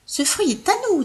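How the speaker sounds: high, tiny mouse voices; squeaky mice. Acted. Mice claiming the fruit, squeaky and proud. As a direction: chirp it out proudly. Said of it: "dirent-elles".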